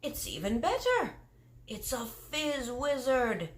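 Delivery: the kindly giant marvelling at his catch; lightly voiced giant. Giant marvelling at his catch to Sophie, gently voiced. BFG voice over the fizz wizard.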